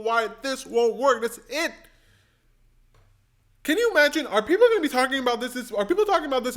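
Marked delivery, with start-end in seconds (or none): 3.6-6.6 1 fast excited rave. Real, not acted. Excited rant.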